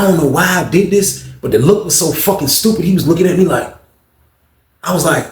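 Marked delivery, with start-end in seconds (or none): none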